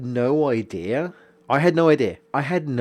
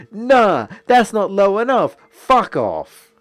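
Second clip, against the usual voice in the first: mocking the customer; 7 semitones higher, 5 dB louder than usual. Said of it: "And he's like".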